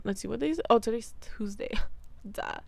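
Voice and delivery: a quick whispered realisation; whispered aside. Real, not acted. Vlogger reminding herself of the day, whispering. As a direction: whisper it to yourself.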